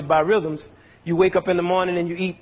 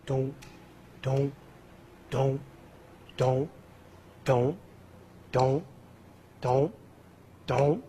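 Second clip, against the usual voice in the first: soft, even "don't, don't" like a heartbeat; steady pulse beat; 4 semitones lower, 8 dB quieter, much slower than usual. Shown as a demonstration. Teacher to class, showing the pulse: soft steady beat sounds. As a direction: tap out the pulse with your voice, softly and evenly. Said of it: "what does our pulse sound like".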